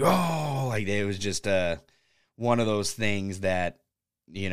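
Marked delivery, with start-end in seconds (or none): 0.0-0.7 imitating a crowd's sudden higher "Oh!"; crowd pop. Recreating the crowd reacting to the promo line, mock surprised pop. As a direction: mimic the crowd gasping at the shot. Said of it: "everybody fucking popped".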